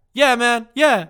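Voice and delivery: hammed-up imitation of the apologetic driver; mock other driver.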